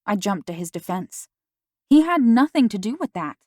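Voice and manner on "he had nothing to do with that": raised, insistent denial; loud defensive outburst; indignant protest. Acted. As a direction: push back hard against her. Defending Damien to mom.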